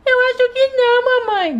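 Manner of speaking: put-on high childlike voice of a small daughter; little girl voice. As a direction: play the sick little girl talking to mom. Sick doll answers.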